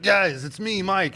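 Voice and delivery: high, loud desperate call; breaking through possession. Retold or imitated. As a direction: mimic someone briefly surfacing from a possession. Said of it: "you break through a little bit".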